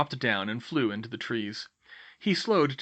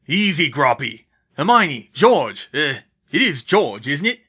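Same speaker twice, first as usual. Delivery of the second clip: loud, broad rustic big-man voice; hearty, recognising friends; Hagrid, soothing then surprised; 3 semitones higher, 10 dB louder than usual. Acted. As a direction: greet them heartily while calming a giant.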